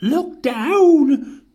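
high, loud warning yell. A narrator's reading. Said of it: "who yelled".

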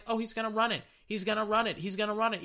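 high, sing-song prediction; high mock chant; mocking fans. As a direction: parrot the crowd in a high smug voice. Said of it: "everybody's like".

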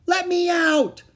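high, loud cry to be let out; panicked high shout. A narrator's reading. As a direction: yell urgently at the top of the voice. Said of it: "he heard Annie shout".

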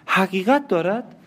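high, rising, stunned question; the wife repeats the husband's confession in horror; shocked disbelief. Acted. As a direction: echo it back as if you can't believe it. Marianne echoes in disbelief.

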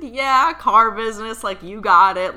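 loud, high burst of recognition; retold excited confirmation. Client confirming medium's guess about dad, thrilled. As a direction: cheer the correct guess loudly.

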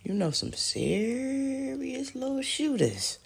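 lightly voiced, drawn-out admiring tease; mock admiring quote. Imitating him praising her shooters, slow and high. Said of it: "I know he's saying".

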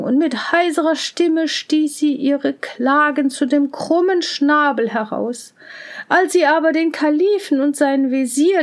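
ordinary delivery

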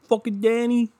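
mocking the neighbor's name in a squeaky voice; mock high voice.

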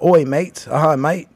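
high, loud mock British "Oi, mate"; a quick cheeky London accent "Oi, mate"; British mate imitation. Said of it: "they say mate a lot".

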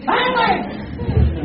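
very high, drawn-out mock call; grito burlón imitado. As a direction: imitate friends hollering it after someone. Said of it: "los amigos le gritan a uno". (grito agudo y alargado, imitando a los amigos)

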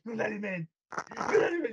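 acting out Kingston yelling at Tony Khan; hammed-up furious promo, voice jumping up; mock angry rant. Kingston confronting Tony Khan, mock angry shout. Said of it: "he was going to Tony Khan".

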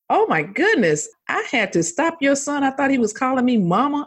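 a hint of the astonished niece on the phone; excited niece.

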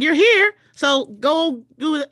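high, loud, pushy command; loud insistent push. Retold or imitated. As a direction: shove them on stage, loud and high. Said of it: "'Cause they're like".

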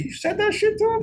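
floored by what he dared say; stunned disbelief. Retold or imitated. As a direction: hit it like you can't believe your ears. Stunned by Ray's defiance.

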